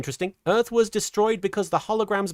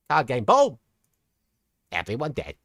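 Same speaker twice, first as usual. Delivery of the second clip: theatrical villain, falling to a low finish; Paradox; 6 semitones lower than usual. Acted. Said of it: "who am I to question Paradox".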